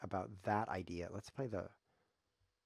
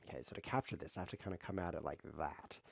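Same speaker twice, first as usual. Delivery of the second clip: ordinary talk, nothing marked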